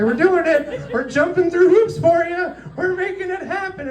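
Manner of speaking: very high squealed cheer from an eager troupe; frantic, high-pitched eager-to-please voice; performing troupe. Acted. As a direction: sing out high like jumping performers. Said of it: "be like, like a dancing, jumping band".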